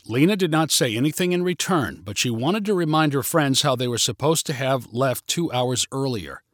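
ordinary delivery, nothing marked

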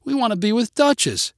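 put-on high little-girl plea; child's cry. A narrator's reading. Girl telling dad she wants the dog, high cry. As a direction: insist in a high, upset child voice. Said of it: "cried Mary".

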